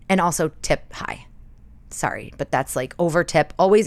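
ordinary talk, nothing marked

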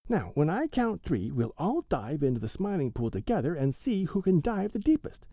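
a small ringleader giving rules; Billy Mink voice. A narrator's reading.

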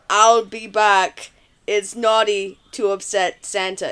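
put-on low, half-hearted Austrian accent; Arnold impression. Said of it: "I wanna hear your Arnold".

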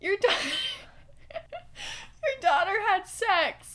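high, loud, breaking into laughter; laughing confession. Retold or imitated. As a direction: blurt the retold line while cracking up. Embarrassed confession retold, cracking up.

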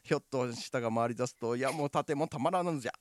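put-on stiff old-style speech recital; formal recital. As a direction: recite it like a stage pitch. Tongue-twister recital, second paragraph.

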